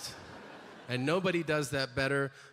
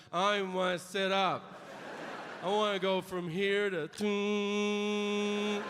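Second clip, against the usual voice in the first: slow, drawn-out sleepy voice; lazy half-asleep moan; groggy whine; 4 semitones higher, slower than usual. Retold or imitated. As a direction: drag it like you just woke up.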